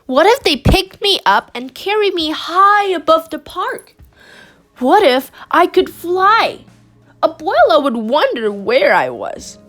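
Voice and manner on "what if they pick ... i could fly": ordinary delivery, nothing marked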